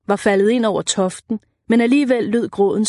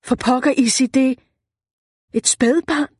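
"For pokker, Issi" high, falling on "Issi"; amazed, rising higher to the question; astonished exclamation; 5 semitones higher than usual. A narrator's reading. Tom shows Isabel the baby, high astonished exclamation. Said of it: "udbrød han".

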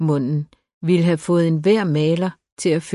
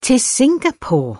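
high-pitched startled cry, falling at the end; near-scream; 9 semitones higher, 4 dB louder than usual. A narrator's reading. Daughter hears she is being sent abroad, near-scream of a question. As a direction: cry it out in alarm, pitched well up. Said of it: "lød nærmest som et skrig".

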